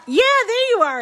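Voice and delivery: high, excited burst of recognition; delighted greeting. Real, not acted. Host spots the winner among viewers, high delighted cheer. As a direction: leap up in pitch, thrilled to spot them.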